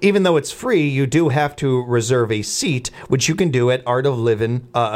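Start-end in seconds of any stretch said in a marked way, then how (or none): none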